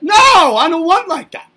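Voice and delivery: high, loud scolding no; a parent refusing the kid, high and loud; parent snapping no. Retold or imitated. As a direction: shout it down like a cross parent. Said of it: "something that's like".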